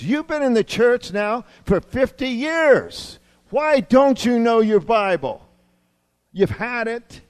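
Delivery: high, loud, slow pulpit challenge; exasperated preacher's rebuke. Acted. Pastor challenging fifty-year churchgoers on Bible knowledge, exasperated. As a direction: hammer the question out, loud and drawn out.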